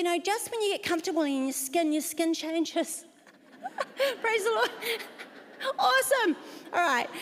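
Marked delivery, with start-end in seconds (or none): 3.6-4.2 high laugh. Real, not acted. Laugh after skin joke.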